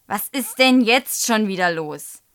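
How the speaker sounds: loud, impatient teacher's demand; cross. A narrator's reading. Teacher to pupil disrupting class, cross. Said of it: "sagt Frau Schröder böse".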